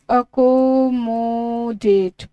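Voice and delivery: Accommodate stretched very slowly, syllable by syllable; accommodate said slow and sustained, every vowel held long; drawn-out pronunciation. Shown as a demonstration. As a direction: draw out each syllable of accommodate for dictation. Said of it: "pronunciation बहुत ज़रूरी है".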